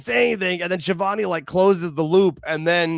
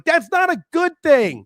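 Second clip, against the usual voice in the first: high, loud mock protest; emphatic objection; 10 semitones higher, 5 dB louder than usual. Podcaster retells reacting to a tweet about Brody, loud protest. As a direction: push it up in exasperated disagreement. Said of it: "I'm like".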